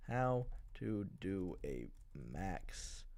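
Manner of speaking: low, slow, quiet mutter; absent-minded, drawn-out search-bar mumble; muttering while typing. Real, not acted. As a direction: sound it out slowly under your breath while typing.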